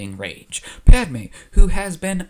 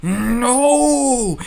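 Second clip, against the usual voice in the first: high, loud anguished cry; 12 semitones higher, 6 dB louder than usual. Retold or imitated. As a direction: wail it like a cornered young man. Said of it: "And Anakin is like".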